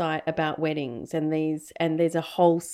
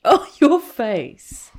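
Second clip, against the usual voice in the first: laughing; 4 semitones higher, 9 dB louder, much slower than usual. Real, not acted. Reacting to host's shocked face.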